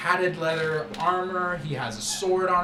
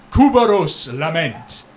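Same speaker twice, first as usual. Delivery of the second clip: louder, slow announcement of the name; grand announcement; 11 dB louder than usual. Acted. The host announces the coven leader's name with a flourish.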